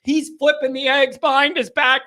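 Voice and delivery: usual speaking voice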